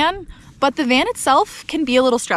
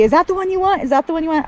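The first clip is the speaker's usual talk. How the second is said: voicing the dog; 6 semitones higher, much faster than usual. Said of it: "just to see".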